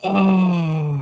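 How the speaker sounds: high cry of laughter near tears; helpless high wail of laughter; laughing to tears. Real, not acted. Streamer overcome reading funny chat comments, laughing till near tears. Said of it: "I wanna start crying".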